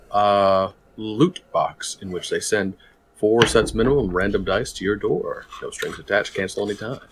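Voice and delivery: smooth game show host pitch; radio announcer voice. Acted. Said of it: "calm, like, radio announcer voice".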